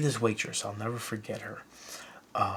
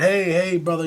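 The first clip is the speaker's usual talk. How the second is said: lightly voiced wrestler greeting, higher and louder; Hulk Hogan; 6 semitones higher, 4 dB louder than usual. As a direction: toss out a hearty mock greeting. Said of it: "Hulk's walking, he's messing around".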